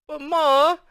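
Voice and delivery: high, loud protest; crying out. A narrator's reading. Girl protesting to her mother, loud. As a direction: cry out in protest. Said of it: "Gal cried".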